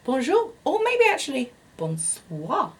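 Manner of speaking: a light French accent on bonjour and bonsoir; French greeting. Shown as a demonstration. Greeting her perfume viewers with a light French accent. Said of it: "or should I say".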